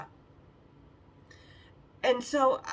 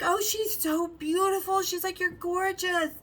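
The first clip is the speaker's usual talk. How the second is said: high-pitched admiring coo; sweet gushing; 8 semitones higher than usual. Retold or imitated. Sales girl complimenting a customer, sweet gushing. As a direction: lay on the compliments, voice way up. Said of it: "she was so sweet".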